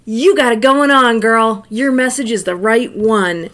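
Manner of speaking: loud, gushing mock praise; hyped-up affirmation. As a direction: belt out the cheerleading compliment. Acting out a stranger's gushing approval.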